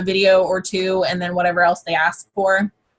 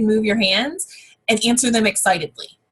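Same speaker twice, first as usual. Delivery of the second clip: normal talking voice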